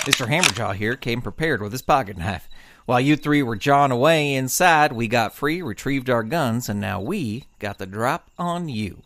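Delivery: low, steady lawman with the upper hand; confident deputy. Acted. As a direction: savor the reveal calmly. Deputy turns the tables.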